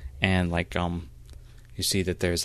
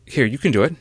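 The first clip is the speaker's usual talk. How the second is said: higher, louder mentor's urging; encouraging; 6 semitones higher, 7 dB louder, faster than usual. Acted. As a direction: cheer on a hesitant student. Mentor urges on a hesitant initiate.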